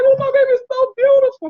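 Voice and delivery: high-pitched, fast mock cooing; imitated doting mom, swept away; gushing new mother. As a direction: gush over the baby in a high voice. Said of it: "they're like".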